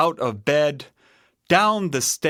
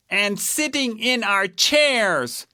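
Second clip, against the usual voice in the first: high little bear voice; accusing Baby Bear; 8 semitones higher than usual. A narrator's reading. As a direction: demand an answer as a baby bear. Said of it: "asked Baby Bear".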